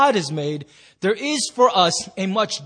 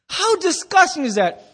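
disgusted; 11 semitones higher, 6 dB louder than usual. Real, not acted. Disgust at triple burger.

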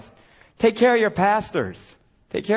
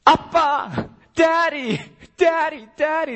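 desperate cry; 11 semitones higher, slower than usual. Retold or imitated. The Spirit cries out in troubled times, a high, pleading call. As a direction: cry out like a frightened child for a father. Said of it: "instinctively cries out".

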